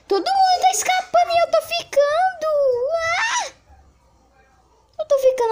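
high loud distressed wail; panicked wail. Real, not acted. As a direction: cry out being left behind. Left behind in game.

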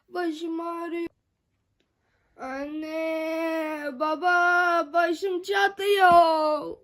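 whiny pretend crying, slow; moaning in pain.

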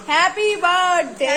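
slow, drawn-out birthday greeting; birthday cheer. Real, not acted. Wishing sister happy birthday, cheering. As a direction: stretch the last word out in celebration.

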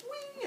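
very high "wing" sound effect; tiny high cartoon zing; imitated magic-weapon noise. Shown as a demonstration. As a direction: sing out a high-pitched whoosh of a blade appearing. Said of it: "She goes like this".

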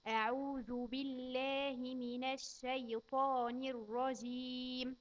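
put-on slow, careful recitation for a child to repeat. Shown as a demonstration. Teacher reciting slowly for a child to repeat. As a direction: spell out each word slowly for the child. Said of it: "قول ورايا".

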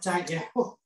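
ordinary talk

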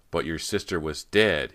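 slow, loud, incredulous cry. A narrator's reading. Rob objecting to Cody's impossible sighting, crying out. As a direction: protest aloud in shock. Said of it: "he cried".

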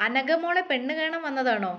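plain reading voice

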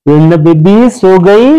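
loud, slow sing-song tease; teasing. Pretend. Husband teasingly checking if wife is asleep, loud and slow. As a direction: drag it out to wake her.

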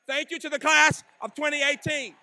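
big finish to the class; loud closing shout. Real, not acted. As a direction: call it out over the cheering crowd. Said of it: "closing thanks, repeated".

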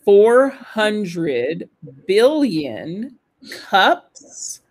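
word by word with long pauses; careful, drawn-out pronunciation demo in small chunks; slow model reading. Shown as a demonstration. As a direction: read slowly so learners can shadow. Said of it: "Could you please do it again?".

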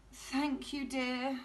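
usual speaking voice

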